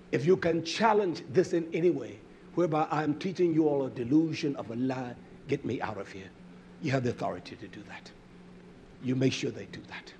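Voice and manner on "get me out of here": ordinary delivery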